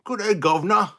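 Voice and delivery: loud, high, hammed-up British accent; stupid British accent. Streamer mocking accents for his viewers, loud stupid British accent. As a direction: belt out a really silly British greeting. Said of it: "a really stupid British accent".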